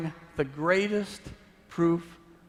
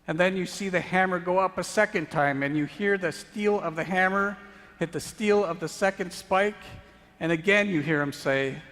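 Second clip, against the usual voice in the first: loud, higher, driving sermon narration; preaching at full force; 4 semitones higher, 5 dB louder than usual. Acted. Preacher to congregation, full force. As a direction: hammer each blow home.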